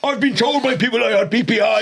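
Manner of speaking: high mock indignation; insistent angry caller. Caller disputes the call centre's searches, imitated high indignation. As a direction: imitate an aggrieved customer arguing back. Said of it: "he'd just shout at you".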